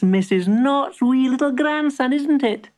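high, cheery shopkeeper. Acted. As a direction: welcome him in brightly. Shopkeeper greets boy.

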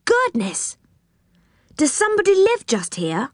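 high-pitched, astonished call. A narrator's reading. Rick calls to the others in the tree, high surprised call. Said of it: "he called to the others".

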